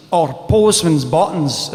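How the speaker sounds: ordinary talk, nothing marked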